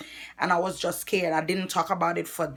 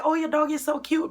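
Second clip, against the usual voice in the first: gushing; 10 semitones higher than usual. Retold or imitated. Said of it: "I'll say".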